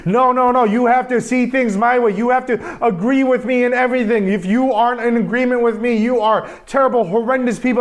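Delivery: imitating an angry online ranter; mock loud ranting. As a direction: rant like someone shouting their views online. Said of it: "getting louder and louder and louder and saying".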